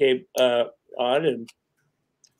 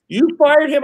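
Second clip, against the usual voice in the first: mock surprise; 11 semitones higher than usual. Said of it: "She goes".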